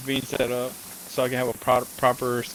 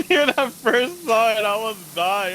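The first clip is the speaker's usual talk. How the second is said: laughing; 10 semitones higher, 4 dB louder than usual. Real, not acted. Recalling the skit, laughing.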